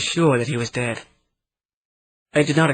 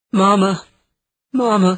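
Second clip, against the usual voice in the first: crying for mama; 8 semitones higher, 5 dB louder than usual. Acted. Regressed woman crying for mother.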